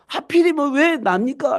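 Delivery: high, drawn-out "why me" complaint; put-upon, slow and high-pitched; indignant protest. Acted. Acts out protest at being chosen.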